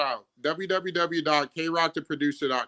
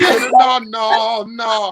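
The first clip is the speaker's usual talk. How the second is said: shouting no no no fading; loud repeated no; 5 semitones higher, 8 dB louder, much slower than usual. Real, not acted. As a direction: push it away, loud then calmer. Refusing mint chip.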